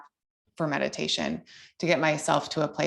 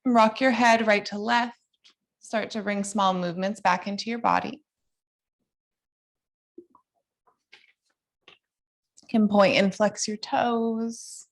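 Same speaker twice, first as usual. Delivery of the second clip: higher, gentle guiding cues; yoga instructor voice; 4 semitones higher than usual. Acted. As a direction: lead the class through poses calmly.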